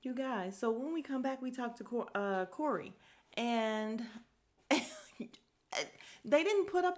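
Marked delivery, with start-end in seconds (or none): 3.4-7.0 breaking up with laughter, slowly; laughing. Real, not acted. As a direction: try to get it out through real laughter. Cracks up recalling his crying.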